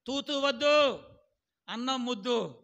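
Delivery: put-on loud, playful telling-off of a little boy; mock scolding grandson. Pastor quoting his little grandson to the church, loud mock scolding. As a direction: bark it like a cheeky toddler scolding. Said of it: "నా చిన్న మనవడు చెప్తున్నాను".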